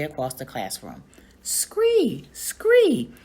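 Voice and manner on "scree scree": high, loud piercing screech; frog alarm screech. Acted. Og alarmed in the empty classroom, shrill screech. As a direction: shriek it like a frantic alarm. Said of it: "It was Og's alarm call".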